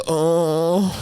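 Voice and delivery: high strained "oh"; pained groan. Retold or imitated. Sick on the floor, a high pained groan.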